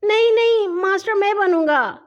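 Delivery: high, loud whiny refusal; shrill little-boy insistence; pouting protest. A narrator's reading. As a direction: protest like a sulking child. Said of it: "ہاشم منہ بسورتے ہوئے بولا".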